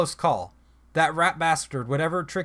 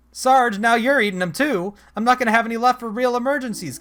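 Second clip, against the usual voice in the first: angry complaint; 6 semitones higher, 5 dB louder than usual. Acted. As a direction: scold Sarge for eating her food. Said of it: "staring at me angrily".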